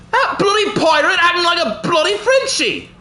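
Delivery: indignant shout. Pretend. Shouting at enemy pirate.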